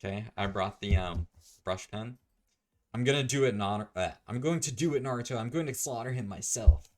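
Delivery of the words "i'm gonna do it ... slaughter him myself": loud, higher-pitched anime declaration; hammed-up vow. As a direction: declare it with mock-heroic fury. Acted anime-style vow to slaughter.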